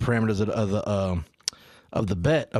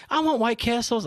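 put-on child voice; 10 semitones higher than usual. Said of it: "son's reply".

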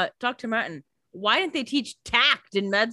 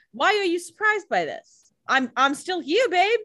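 higher, louder sassy Catherine voice; mock Catherine retort; 12 semitones higher, 3 dB louder than usual. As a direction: needle them like a smug survivor. Cohost voicing Catherine's defiant retort.

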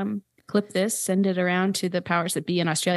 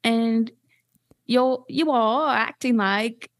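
high, pleading, slightly British character voice; a forgotten creature insisting it still exists; self-conscious extinct lizard; 5 semitones higher, much slower than usual. Pretend. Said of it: "give us a little tidbit of the voice".

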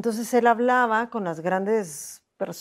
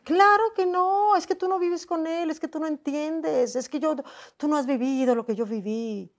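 fierce defensive retort; 7 semitones higher than usual. Retold or imitated. Woman fiercely retorting to those who blamed her, indignant. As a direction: bristle like a lioness defending herself. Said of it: "yo me puse como león".

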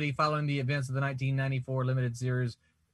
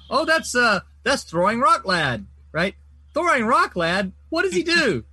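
mock hero voice; 7 semitones higher, 7 dB louder than usual. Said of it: "It's like".

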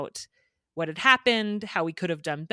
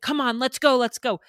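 imitating an impatient kid, higher and louder; agitated child; 7 semitones higher, 6 dB louder than usual. Parent imitating agitated son hurrying her home, impatient. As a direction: push to leave right now, retelling a cranky child. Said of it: "the one was clearly really agitated".

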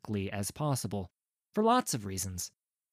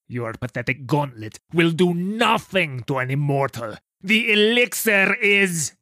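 booming villain boast; 7 dB louder than usual. Acted. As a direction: gloat like a triumphant brute. Immortal villain boasts loudly.

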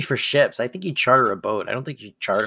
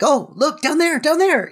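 much higher, eager call; mock excited spotting; 12 semitones higher than usual. Cohost inventing a helicopter line, high excited call. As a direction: point and call out high like spotting someone below. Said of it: "like, they go".

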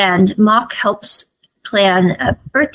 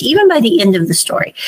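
ordinary delivery

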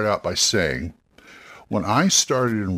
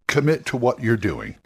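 usual speaking voice

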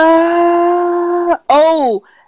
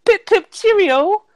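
mock English accent; 4 semitones higher than usual. Mocking English posh speech.

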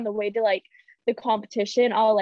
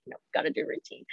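hushed reminder to herself; quiet stern whisper; whispered self-talk; 7 dB quieter than usual. Retold or imitated. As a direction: whisper it to stay focused. Self-talk to stay focused.

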